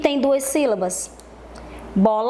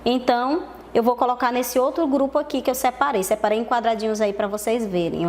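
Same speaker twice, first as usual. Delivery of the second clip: normal talking voice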